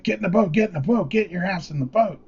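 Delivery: frantic, high-pitched urgent orders; imitating a friend's agitated, repeated command; freaking out. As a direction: yell it like a panicked fisherman. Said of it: "He's freaking out".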